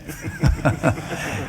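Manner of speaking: breathy chuckle; short unvoiced laugh. Real, not acted. Laughs at mix-up.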